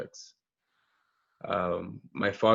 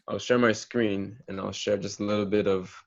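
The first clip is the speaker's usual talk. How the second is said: usual speaking voice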